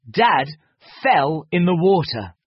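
plain reading voice

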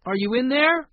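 high, loud questioning call. A narrator's reading. Boy at the closed cabin door, calling out. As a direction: call to whoever's inside. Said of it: "he called".

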